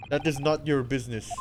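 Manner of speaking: put-on loud, boastful Russian accent; Russian sailor accent. Sailor boasting about the first class area, Russian accent. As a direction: puff up like a guard in Russian accent.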